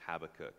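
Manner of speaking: Habakkuk said with stress on the first syllable; common pronunciation. Shown as a demonstration. Preacher naming the prophet for the congregation, common pronunciation HAB-uh-kuk for Habakkuk. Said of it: "whatever you wanna pronounce it with".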